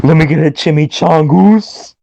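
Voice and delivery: hammed-up fast-food ordering bit; exaggerated Spanish-accent order; mock Taco Bell order.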